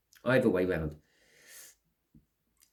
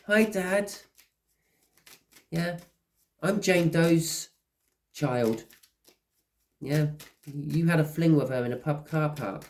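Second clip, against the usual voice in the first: high, put-on voice of the grown child greeting Dad; long-lost child; 7 semitones higher, slower than usual. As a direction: play the stranger child announcing themselves, pitched up. Long-lost child meeting father.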